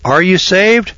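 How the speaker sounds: high preachy question; mock preacher question. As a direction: ask it like a pious preacher. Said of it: "would you ask me".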